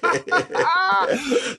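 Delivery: high, howling burst of laughter; shrieking laugh. Real, not acted. Guest howls with laughter at the host's chip ad joke. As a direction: crack up hard, almost crying.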